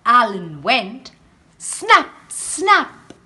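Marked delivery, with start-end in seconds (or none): 1.6-3.1 high loud snap snap; snapping jaws. A narrator's reading. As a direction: bite the air, snap snap. Crocodile snapping sound effect.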